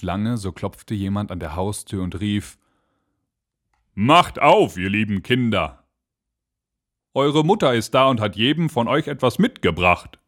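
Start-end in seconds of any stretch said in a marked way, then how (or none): 3.9-10.2 wolf calling at the door, undisguised; lightly voiced wolf, well below the goats' squeak; rough-voiced wolf. A narrator's reading. Wolf knocks and calls to the kids, rough-voiced wolf. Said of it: "an der rauen Stimme".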